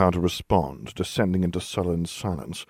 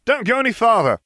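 loud, high-pitched warning call; shouting; 7 dB louder than usual. A narrator's reading. Lupin warns the children from behind, shouting. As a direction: shout after them urgently. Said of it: "a voice shouted from behind us".

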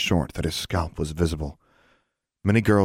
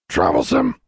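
a raspy parrot squawk; squawking bird; 4 dB louder than usual. Acted. Bird on her shoulder squawking, mock parrot. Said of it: "squawked Charlie".

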